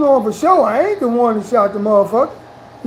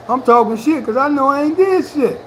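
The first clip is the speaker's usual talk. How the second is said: ordinary talk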